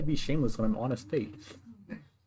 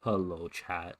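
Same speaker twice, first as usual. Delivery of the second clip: folksy pandering politician; 5 semitones lower, 6 dB quieter, much slower than usual. Pretend. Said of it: "You sound like a politician right now".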